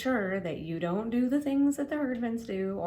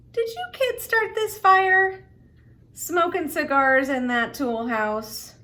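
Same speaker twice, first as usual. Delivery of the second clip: much higher, louder, slow accusing demand; stern fireman; 9 semitones higher, 13 dB louder, slower than usual. Acted. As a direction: grill the kid like an angry fireman. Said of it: "One fireman even collared Claude Herdman and said".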